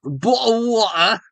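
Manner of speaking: high, rising British "Bottle of water"; mock British accent. Repeating the running joke in a mock British accent. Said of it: "the accent".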